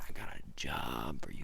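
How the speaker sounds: low whispered mock Santa; low whispered offer. Santa repeating his job offer, whispered low. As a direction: hush it low like a secret deal. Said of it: "And he said".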